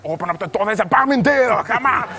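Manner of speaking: high, loud urgent shout; mock action yell. Actor in scene yelling to open the door.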